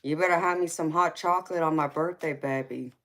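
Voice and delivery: normal talking voice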